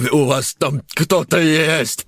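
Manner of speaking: gurgling. Acted. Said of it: "пробулькал Семён".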